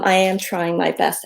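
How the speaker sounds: ordinary talk, nothing marked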